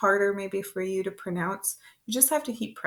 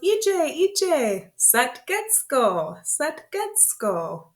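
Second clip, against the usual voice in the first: high singsong wake-up call; calling to a child; 7 semitones higher, 3 dB louder, much slower than usual. Pretend. Parent telling a child to wake and get up, high calling voice. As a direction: call it high, then draw it out. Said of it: "So you can say to your child".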